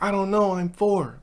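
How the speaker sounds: put-on high little-kid voice, unsure; a small child mumbling an answer. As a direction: play the shy four-year-old. Dad voicing his four-year-old.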